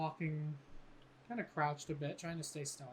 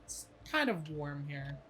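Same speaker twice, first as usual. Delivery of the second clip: game master scene setting; 6 dB louder than usual. Acted. GM sets the dream scene.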